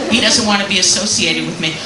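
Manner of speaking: usual speaking voice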